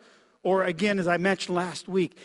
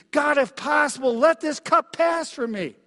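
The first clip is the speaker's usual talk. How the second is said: a higher, louder plea to God; pleading; 7 semitones higher, 4 dB louder than usual. Acted. Preacher voicing Jesus in Gethsemane, pleading. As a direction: voice Jesus begging in the garden. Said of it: "he's pleading, he's lamenting".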